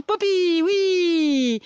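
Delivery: high loud happy dog greeting; praising a dog. Real, not acted. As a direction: greet the dog with big joy. Greeting returning dog happily.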